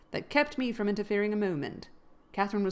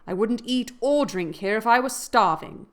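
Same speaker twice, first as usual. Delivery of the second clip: defiant; 3 semitones higher, 8 dB louder than usual. A narrator's reading. Catherine defies Heathcliff.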